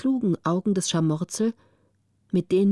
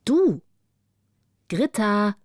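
calling out; 3 dB louder, slower than usual. A narrator's reading. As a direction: call to rouse someone from a dream. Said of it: "rief eine Stimme". (rufend, zuerst hoch und laut)

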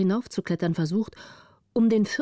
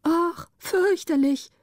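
high, drawn-out cry of horror; horrified exclamation; 10 semitones higher, slower than usual. A narrator's reading. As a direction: gasp it out slowly in horror. Lookout horrified by what she sees. (entsetzt, hoch und gedehnt)

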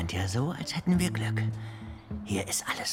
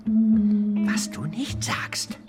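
sarcastic whisper; 7 semitones higher, 4 dB louder, much slower than usual. Acted.

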